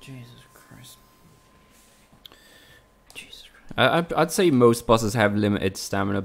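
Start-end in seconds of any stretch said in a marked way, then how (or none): 0.0-3.7 breathless whispered oath; stunned whisper. Real, not acted. Gamer to the game, stunned whisper. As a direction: whisper it in disbelief.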